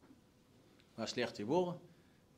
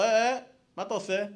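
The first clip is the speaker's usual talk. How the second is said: louder, higher "caught you" call; mock alarmed challenge; 6 semitones higher, 8 dB louder than usual. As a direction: stop a culprit in the act, hammed-up. Angel catches rabbi stealing.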